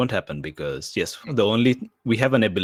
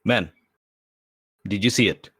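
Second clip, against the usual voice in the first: high, loud breathless wonder; eager, shaken, grabbing the guard; excited; 5 semitones higher, 6 dB louder than usual. Acted. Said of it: "and he just says".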